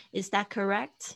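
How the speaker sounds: "Is that correct?" with the voice lifting at the end; rising intonation. Shown as a demonstration. Teacher models "Is that correct?" for learners, rising intonation. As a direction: lift "correct" into a question. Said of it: "所以它上扬的声音".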